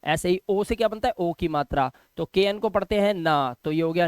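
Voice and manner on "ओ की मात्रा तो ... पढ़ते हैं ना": normal talking voice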